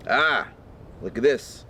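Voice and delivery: usual speaking voice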